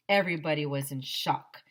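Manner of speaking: usual speaking voice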